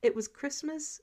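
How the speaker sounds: ordinary delivery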